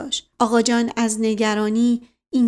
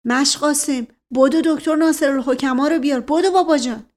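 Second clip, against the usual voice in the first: urgent raised voice, higher pitch; shouted order; 4 semitones higher, 4 dB louder than usual. A narrator's reading. Man sends a servant running for the doctor, shouting. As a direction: call out sharply for help. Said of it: "فریاد زد".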